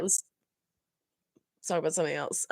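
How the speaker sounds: normal talking voice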